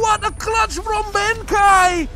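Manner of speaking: slow, high, loud; excited shout. Real, not acted. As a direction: roar out the clutch. Clutch won, caster shouts.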